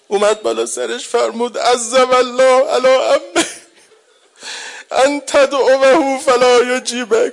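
high, loud crying lament; mourning preacher voicing uncle's grief; weeping lament.

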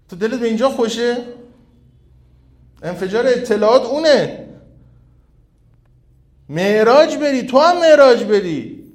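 loud, high-pitched exhortation from the pulpit; impassioned preaching. Acted. Preacher exhorting at full force.

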